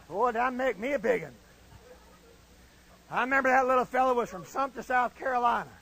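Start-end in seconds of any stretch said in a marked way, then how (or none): none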